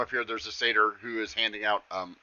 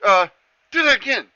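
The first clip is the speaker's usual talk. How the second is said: much higher and louder, begging for more; excited satyr; 11 semitones higher, 8 dB louder, much faster than usual. Acted. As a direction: beg for another trick like a thrilled kid. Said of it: "he's like".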